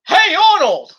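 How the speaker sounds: high, loud shouted call; mock call-out. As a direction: yell it out like spotting a cartoon kid. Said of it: "and you go".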